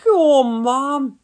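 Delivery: mocking young son; snorting Norman. A narrator's reading. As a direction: snort it like a cheeky kid. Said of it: "he snorted".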